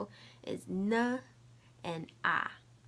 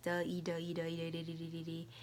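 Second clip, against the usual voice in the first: "De, e" repeated quicker and quicker into "dee"; said fast, blending; 5 dB quieter, faster than usual. Shown as a demonstration. Teacher showing how two sounds combine, rapid repetition. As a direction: run "de, e" together rapidly, fading as it blends. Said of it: "you can say it fast".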